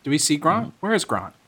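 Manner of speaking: ordinary delivery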